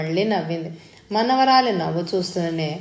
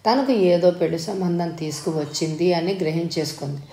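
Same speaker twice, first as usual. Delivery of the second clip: ordinary delivery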